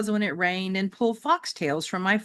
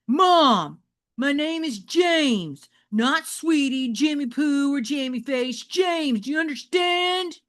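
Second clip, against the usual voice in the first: put-on higher, louder, slow and insistent teenager; indignant teen; 8 semitones higher, 5 dB louder, slower than usual. James correcting Mom about his name, indignant teen voice. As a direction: lay down the law like a touchy kid.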